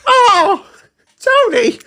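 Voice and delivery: very high, hammed-up Jersey wail; loud mock New Jersey cry from a TV grandparent; Sopranos Jersey accent. Podcaster parodies The Sopranos, high wailing Jersey accent. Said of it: "I've been watching The Sopranos a bit".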